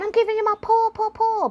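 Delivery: very high and loud, a bouncy dog jumping up; a big dog happily barrelling into a kid; overexcited cartoon dog voice; excited big dog voice. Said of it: "it's going".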